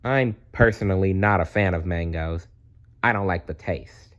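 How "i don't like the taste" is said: ordinary delivery, nothing marked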